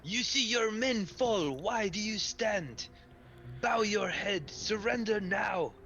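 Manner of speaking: high, slow, booming command; commanding yell. Acted. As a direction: order him to surrender. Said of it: "yells at him".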